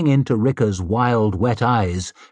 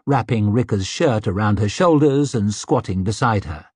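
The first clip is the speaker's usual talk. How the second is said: plain reading voice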